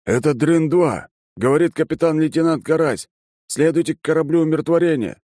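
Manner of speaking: high, projected military reply; officer on radio. Acted. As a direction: announce callsign and orders as an officer. Officer answers by radio with callsign.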